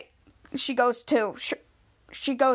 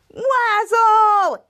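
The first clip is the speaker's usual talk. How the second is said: loud, high, drawn-out holler; group cheer; 8 semitones higher, 7 dB louder than usual. Retold or imitated. All players shouting at the dice roll, drawn-out cheer. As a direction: imitate everyone calling out together. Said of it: "all of them said".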